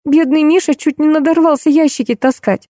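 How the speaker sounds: put-on high plaintive girlish voice; lamenting. A narrator's reading. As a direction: fret over someone in a thin high voice. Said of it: "продолжала причитать девушка". (жалобно причитает тонким голосом)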